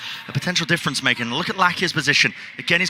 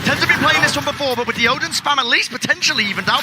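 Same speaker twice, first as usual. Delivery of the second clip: raised, urgent live call; excited play-by-play; 11 semitones higher, 3 dB louder than usual. Real, not acted. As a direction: call the action with rising energy. Caster calls a fight.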